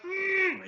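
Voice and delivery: a high, strained closed-mouth groan; short whimpering hum of pain; imitated pained groan. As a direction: mimic a paralyzed man groaning. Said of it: "and he's groaning, like".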